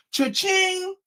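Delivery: high-pitched, ringing "cha-ching"; gleeful mock till ring; cash-register cha-ching. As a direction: chime like money dropping in. Mimics the money arriving.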